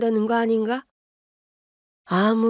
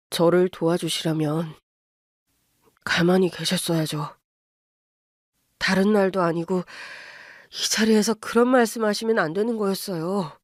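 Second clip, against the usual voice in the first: apologetic son-in-law. Acted. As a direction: hold it down, sorry but firm. Son voice again.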